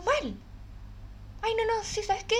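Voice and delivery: high, teary confession; retelling a sob to the therapist, high and teary; tearful high voice. Retold or imitated. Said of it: "empecé a llorar".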